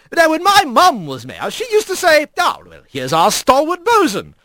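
high, chatty skipper voice; genial, lilting dignitary; jovial otter mayor. Acted. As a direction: reminisce breezily with swooping pitch.

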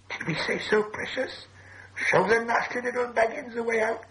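suspicious cave creature talking to itself; Gollum. A narrator's reading. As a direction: bargain slyly in a squeaky creature voice. Plays Gollum answering Bilbo.

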